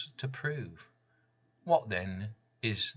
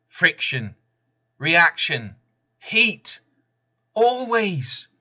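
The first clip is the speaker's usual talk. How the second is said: rising, forceful build; 7 semitones higher, 8 dB louder, slower than usual. Acted. Building rhetorical list.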